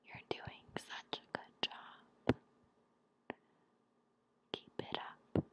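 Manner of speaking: slow, soft ASMR whisper; hushed, close-up reassurance; whispered encouragement. Real, not acted. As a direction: whisper gentle praise to the listener.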